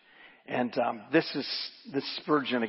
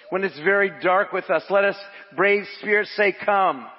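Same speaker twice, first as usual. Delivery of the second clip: raised, emphatic sermon delivery; preaching; 7 semitones higher, 7 dB louder than usual. Acted. Preacher reads a commentary to the congregation, raised emphatic preaching. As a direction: preach the quotation with building conviction.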